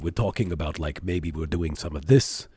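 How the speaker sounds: low, heavy New York impression; exaggerated New York accent.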